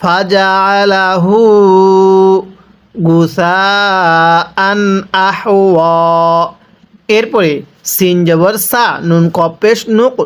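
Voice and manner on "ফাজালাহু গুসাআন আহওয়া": slow drawn-out tajweed chant; Quran recitation. Shown as a demonstration. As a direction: stretch every vowel like a teacher reciting. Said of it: "কিভাবে পড়তে হবে?".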